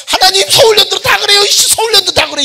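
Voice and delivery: high loud lament. Retold or imitated. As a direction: cry out in bitter complaint. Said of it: "한탄하듯이". (높고 크게 한탄하며)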